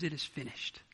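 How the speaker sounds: very soft, low "It is finished"; a dying man's final breath of words; hushed last words. Acted. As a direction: let it fall almost to nothing. Jesus' last words on cross.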